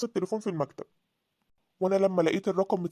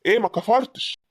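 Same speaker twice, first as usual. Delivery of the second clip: loud, forceful rebuke; angry shouting back; 6 dB louder than usual. Acted. Husband tells wife to respect herself, angry shouting. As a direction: warn her off at raised volume.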